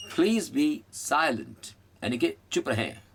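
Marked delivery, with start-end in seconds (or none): 0.1-1.7 "silent" with the first syllable raised; stress on silent. Shown as a demonstration. Teacher shows students where "silent" is stressed. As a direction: say "silent" with "sai" up, "lent" down. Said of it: "यहाँ पर ये उठता है silent".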